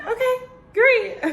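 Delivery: high-pitched giddy squeak with a laugh; giddy reply. Retold or imitated. Candidate hears she will get a call, giddy high reply. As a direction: play back delighted surprise, very high. Retelling her thrilled reply to interviewer.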